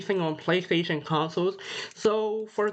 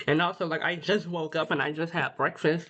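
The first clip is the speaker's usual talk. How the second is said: usual speaking voice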